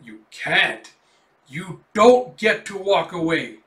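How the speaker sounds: loud, slow monstrous bellow; enraged creature bellowing, slowed down; furious roar. Acted. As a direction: roar at them to stop leaving. Enraged monster as they walk away.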